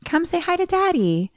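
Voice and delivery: high, coaxing mom voice; mother coaxing. Retold or imitated. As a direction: urge the child to speak up. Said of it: "she's like".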